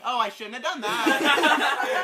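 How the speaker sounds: drawn-out regret breaking into laughter; retold regret. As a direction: laugh at your own mistake. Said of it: "I was like".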